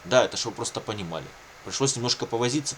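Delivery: ordinary delivery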